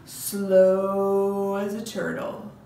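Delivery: very slow, with long held vowels; slow as a turtle. Shown as a demonstration. Teacher sets up the second round, very slow and stretched. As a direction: drag the words out like a turtle. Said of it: "All right, the second time".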